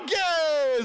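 high, stretched squeal; match the kid's excitement; mock thrilled dad; giddy agreement.

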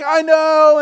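loud, high smug reply; dad boasting to the kids before the run; cocky boast. Retold or imitated. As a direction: brag it out to the kids.

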